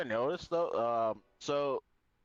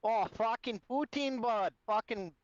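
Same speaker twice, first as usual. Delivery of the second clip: higher-pitched put-on Alberta accent; 9 semitones higher than usual. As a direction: ham up the Alberta hockey-bro talk. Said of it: "Had to bust that out".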